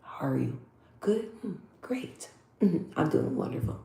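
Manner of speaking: very soft, hushed, toned-down talk; softened-up voice. Shown as a demonstration. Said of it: "soften it up and kinda".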